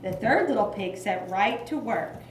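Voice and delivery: ordinary talk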